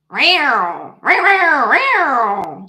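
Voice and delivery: loud, swooping cat yowls; playful cat yowl. Pretend. Reader doing her kitty cat bit for country girl, playful yowling. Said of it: "chit chat with kitty cat".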